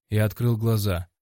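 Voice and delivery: plain reading voice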